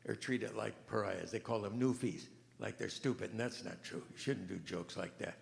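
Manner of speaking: usual speaking voice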